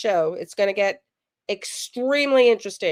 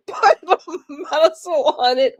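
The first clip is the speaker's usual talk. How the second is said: laughing; 4 semitones higher, 5 dB louder, slower than usual. Real, not acted. Reading a funny comment aloud.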